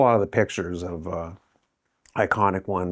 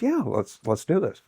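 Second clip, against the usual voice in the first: eager yes; 7 semitones higher than usual. Retold or imitated. President agrees eagerly.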